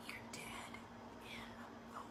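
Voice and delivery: a hushed, breathy whisper that breaks into a laugh; spooky stage whisper with a chuckle. Acted. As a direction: whisper the doom, then crack up. Dramatic warning to auditioning actors.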